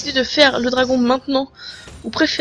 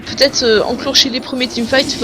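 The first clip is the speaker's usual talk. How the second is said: ordinary talk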